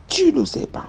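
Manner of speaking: sharp high order; nanny's command. Retold or imitated. The nanny ordering the child to kill the snake. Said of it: "Elle me dit".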